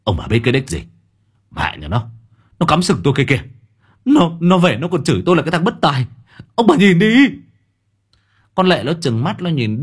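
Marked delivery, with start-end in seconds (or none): 0.0-7.1 higher, louder indignant outburst; loud retort. A narrator's reading. Son to his parents, loud indignant outburst. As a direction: shout back at the parents. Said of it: "Đức lớn tiếng".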